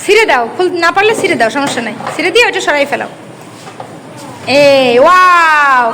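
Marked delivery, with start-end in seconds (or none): 4.6-5.8 long, slow, louder wow of delight; theatrical gasp of wonder; drawn-out wow. Acted. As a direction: stretch out the wow for the kids. Teacher reveals cake to class.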